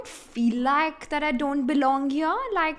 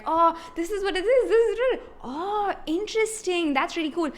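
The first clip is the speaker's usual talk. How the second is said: higher, lively mock-excited quote; excited colleagues; 6 semitones higher than usual. Colleagues showing her a local thing on Google, imitated with bright excitement. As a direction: imitate friendly locals keen to explain. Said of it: "and they're like".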